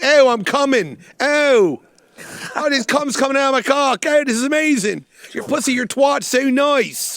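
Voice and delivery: loud, high mock Philly accent; horrible Philly accent. Comic imitating a lover in climax, horrible Philly accent. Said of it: "That horrible Philly accent".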